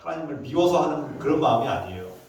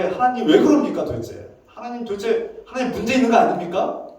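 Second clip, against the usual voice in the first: confronting God; 6 semitones higher than usual. Acted. Prophet boldly challenges God over His inaction, confrontational. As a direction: demand answers in an indignant, higher voice. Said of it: "하고 막 따지는 거예요".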